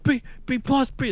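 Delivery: high, mock panicked sputter; freaking out. Dad hearing about a B plus, freaking out. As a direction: sputter it in high-pitched disbelief. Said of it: "they're freaking out".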